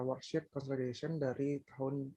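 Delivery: normal talking voice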